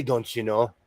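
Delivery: soft, low hint of a Minnesotan accent. Saying the Minnesota phrase to her friend, a hint of Minnesotan accent. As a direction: murmur it like a Minnesotan. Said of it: "I say".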